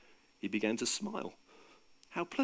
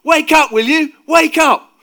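huge, high, drawn-out yell; full-force urgent shout; top-of-voice shout; 18 dB louder, slower than usual. A narrator's reading. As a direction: bellow to wake a sleeper. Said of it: "shouted at the top of his voice".

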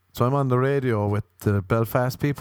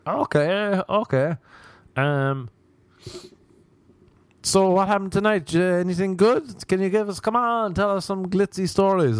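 put-on Irish accent; 9 semitones higher than usual. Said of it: "That's the only accent, Belfast or Dublin".

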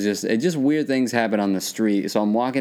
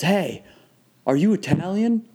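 lightly imitated curbside stranger, pitched up; raised-pitch retold call-out, only lightly acted; retold stranger's question; 9 semitones higher than usual. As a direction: ask it higher, as a stranger pulling up. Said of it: "he goes".

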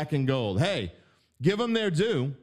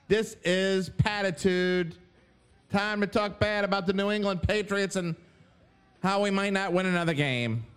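mock a New England accent; Boston accent; 4 semitones higher than usual. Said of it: "use that stupid accent".

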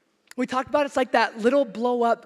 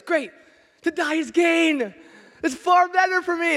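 raised, louder, beaming with joy; joyful Paul; 6 semitones higher, 7 dB louder than usual. Retold or imitated. Paul answers the persecutors, loud and triumphant.

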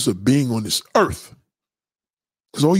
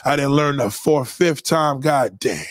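normal talking voice

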